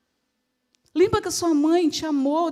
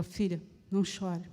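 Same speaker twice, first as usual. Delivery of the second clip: very soft, low consolation; quiet, compassionate plea; tender comforting; 8 semitones lower, 10 dB quieter than usual. Acted. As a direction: hush a grieving daughter gently. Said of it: "Jesus chegou para aquela mulher".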